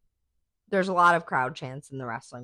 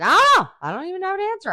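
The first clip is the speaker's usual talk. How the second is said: much higher, loud bewildered outburst; exclaimed; 9 dB louder, much faster than usual. Retold or imitated. Mom yelling back she has no answer. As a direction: blurt it out, stumped. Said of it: "I was like".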